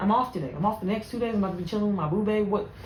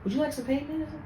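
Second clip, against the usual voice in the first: softer, falsely sweet; sweetly polite nurse reply; 4 semitones higher, 7 dB quieter, much faster than usual. Retold or imitated. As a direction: kill her with kindness. Sweet professional reply to patient.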